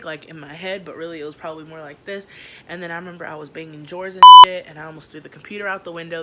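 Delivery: usual speaking voice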